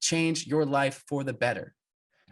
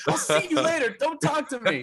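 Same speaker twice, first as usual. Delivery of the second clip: very high squeaked mock dismissal; laughing mock huff. Storyteller parodies rivals snubbing each other side by side, laughing. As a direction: act huffy while cracking up. Laughing replay of absurd goodbye.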